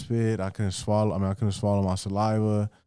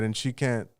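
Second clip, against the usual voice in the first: ordinary talk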